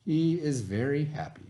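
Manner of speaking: put-on reading a story aloud to children; lively read-aloud for young listeners; expressive picture-book narration; storybook reading. A narrator's reading.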